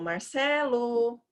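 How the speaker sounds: slow sing-song call of Marcelo; calling a brother. Acted. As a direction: Marcelo with CE held long. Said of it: "você chama seu irmão".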